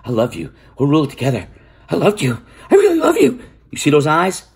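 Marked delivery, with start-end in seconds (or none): 0.1-3.4 rising into a squeaky, over-the-top swoon on "I really love you"; mock Dany love talk. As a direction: parody a lovesick queen with crazy eyes. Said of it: "got right up to him like".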